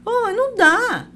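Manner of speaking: high, drawn-out exasperated complaint. Acted. Fed up with the mug on the table, exasperated. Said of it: "É a milésima vez que eu falei".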